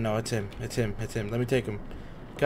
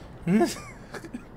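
nervous laugh. Real, not acted. Laughs after the scare.

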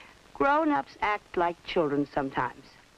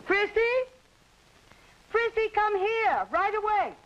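calling out; 11 semitones higher than usual. Acted. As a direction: call the girl over, pitched up high. Calling the child from afar.

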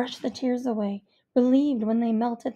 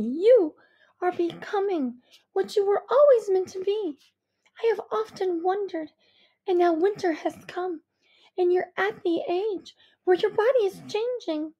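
higher, earnest mother voice; insistent mother; 7 semitones higher, 3 dB louder than usual. A narrator's reading. Mother telling her daughter she is changing, earnest. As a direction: press the point with loving insistence. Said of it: "mother continues insisting".

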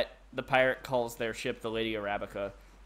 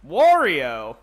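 Wario shout; 12 dB louder than usual. Pretend. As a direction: yell it like Wario.

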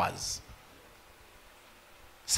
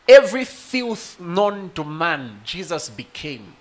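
loud, high, impassioned preaching; full-force preaching; 5 semitones higher, 7 dB louder, slower than usual. Acted. Preacher crying out the cross story to the congregation, fervent.

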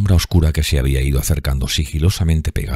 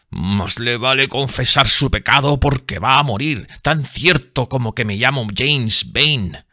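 higher, slow threatening voice; vengeful sailor's threat; menacing sailor; 10 semitones higher, slower than usual. A narrator's reading. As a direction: promise death coldly. James threatens to kill.

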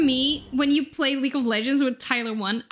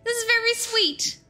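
touched squeal; 7 semitones higher than usual. Real, not acted. Streamer thanking chat for praise, high and gushing. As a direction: gush like the praise got to you.

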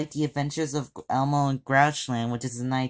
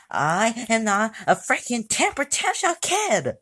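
higher, yelling protest; temper tantrum; 9 semitones higher than usual. Acted. Kid yelling back at friends who call him a tantrum kid, angry. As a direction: shout the denial like a furious kid. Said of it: "see what we mean?".

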